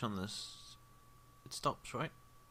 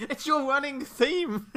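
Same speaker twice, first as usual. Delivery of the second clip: high, loud, drawn-out glee; laughing shout; 12 dB louder, slower than usual. Real, not acted. Game master teasing a player about their recurring fate, laughing shout. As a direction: shriek it through laughter, stretching the words.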